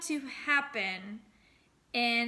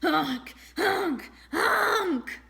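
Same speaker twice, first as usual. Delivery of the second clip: rising, loud honking nose blows; loud nose honks; 6 semitones higher, 7 dB louder, much slower than usual. Acted. Boy blows his nose hard on sleeve, loud honking. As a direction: blast out three goofy honks. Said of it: "he blew his nose really hard".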